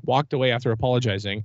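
ordinary delivery

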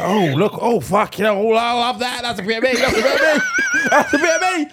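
laughing, mock-delighted impression of Pete; imitating Pete. Mocking how Pete reacts to a photo, gushing and laughing. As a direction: gush over the picture like Pete, giggling. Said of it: "he'll be like".

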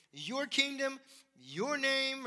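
"your" high and loud in each phrase; stress on your. Shown as a demonstration. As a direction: press on "your", holding the pitch up. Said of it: "the emphasis is not on us".

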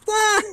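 high drawn-out mock scream; torn fan's yell. Fan whose player scored but lost, mock scream. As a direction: wail it way up high, unsure whether to celebrate. Retells reaction to team losing.

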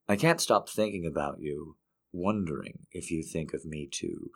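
soft, unhurried love poem reading. Acted. Reads a second poem.

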